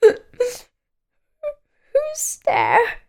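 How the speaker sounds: high, squeaky and sad, with a crying edge. A narrator's reading. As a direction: call out like a forlorn little creature. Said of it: "A sad, squeaky sort of voice".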